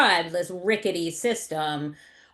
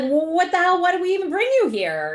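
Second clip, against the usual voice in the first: high, louder exasperated questioning; 9 semitones higher, 4 dB louder than usual. Acted. Caregiver in the emergency room, exasperated. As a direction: climb in pitch as if totally fed up.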